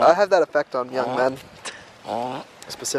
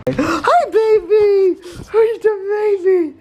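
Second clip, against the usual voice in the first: puppy voice; 4 dB louder, slower than usual. Real, not acted. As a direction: gasp and gush over the puppy, much higher. Greeting a puppy excitedly.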